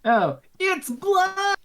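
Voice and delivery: high, drawn-out cry of horror; mock horror. Guest discovers the wine is blood, mock horror. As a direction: wail it out in mock alarm. Horrified diner finding blood.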